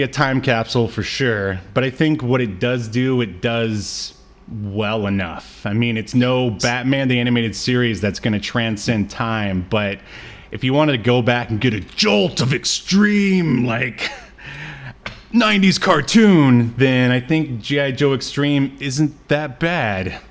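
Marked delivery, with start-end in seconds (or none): none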